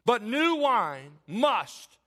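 "new" drawn out and punched, very slow; stress on "new". Shown as a demonstration. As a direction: hammer the word "new", stretching it out. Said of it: "Notice the emphasis on the word new".

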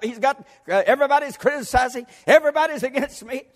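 high, agitated pulpit rant; worked-up preaching. Real, not acted. Preacher reliving how critics rattled him, high and overwrought. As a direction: wail the complaints in mounting waves.

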